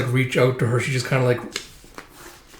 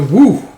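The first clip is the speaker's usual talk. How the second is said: loud, raised whoosh noise; imitated whoosh; 6 semitones higher, 9 dB louder than usual. Narrator imitates the slashing fight, loud whoosh. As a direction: shout a swooping sound effect. Imitates sound of sword action.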